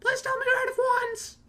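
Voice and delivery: very high, squeaky begging; eager kid. Retold or imitated. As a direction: plead hopefully in a tiny kid voice. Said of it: "he's just like".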